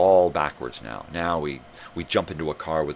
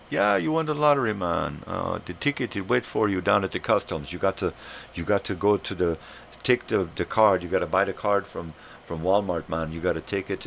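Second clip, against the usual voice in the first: Jamaican scammer. Retold or imitated. Scammer explaining how to claim winnings, mock Jamaican accent. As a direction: reel off the pitch in a Jamaican accent. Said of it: "this guy in Jamaica".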